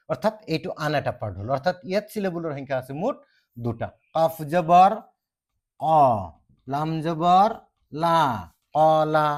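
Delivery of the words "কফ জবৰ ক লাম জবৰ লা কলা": stretched, loud কফ জবৰ ক, লাম জবৰ লা, then কলা; Arabic spelling recitation. Shown as a demonstration. Teacher reciting the first syllable part, loud and drawn out. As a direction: draw out each spelled sound and pause before blending. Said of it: "এটা part গ'ল".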